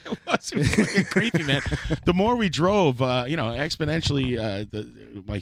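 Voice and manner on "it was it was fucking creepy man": laughing. Real, not acted. Laughing at his own scare.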